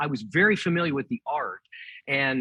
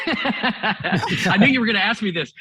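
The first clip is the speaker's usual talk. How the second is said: laughing; 6 semitones higher, 5 dB louder, slower than usual. Real, not acted. Caught out, laughing at the question.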